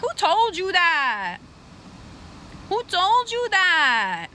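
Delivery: drawn-out, singsong challenge; mock incredulous. The world side-eyeing someone, drawn-out mock incredulity. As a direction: stretch it out slowly, mock disbelief.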